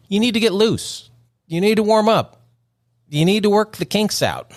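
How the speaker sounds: high, chant-like preacher cadence; mock preaching. Host urges listeners to warm up, mock preaching. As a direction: exhort them in high, separate phrases.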